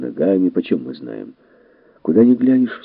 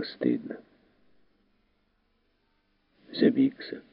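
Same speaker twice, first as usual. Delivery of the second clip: quiet and ashamed; 9 dB quieter than usual. Acted. Ashamed man speaks quietly.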